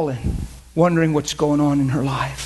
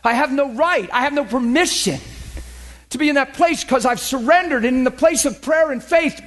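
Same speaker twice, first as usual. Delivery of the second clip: normal talking voice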